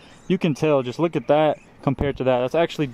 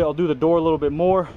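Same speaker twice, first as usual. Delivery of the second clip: ordinary delivery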